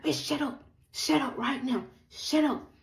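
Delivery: retold furious order, pitch jumping up; angry. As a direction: imitate an enraged boyfriend, high-pitched. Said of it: "because he's so angry".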